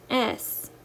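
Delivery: ordinary delivery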